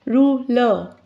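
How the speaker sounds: "Ruler" in two separate beats, ru, ler; split into syllables. Shown as a demonstration. Teacher giving a two-syllable example, "Ruler" split ru-ler. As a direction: pause briefly inside "Ruler" after "Ru". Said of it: "this word has two syllables".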